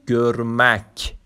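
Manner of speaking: گرمک drawn out slowly, falling; slow Turkish word. Shown as a demonstration. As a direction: say گرمک slowly and clearly like a teacher. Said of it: "گرمک یعنی دیدن".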